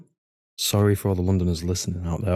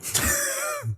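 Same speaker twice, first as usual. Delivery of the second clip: squeaky laugh; 6 dB quieter than usual. Real, not acted. After his joke.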